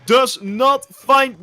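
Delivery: loud, high esports casting; excited play-by-play. Real, not acted. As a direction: shout the action as it unfolds.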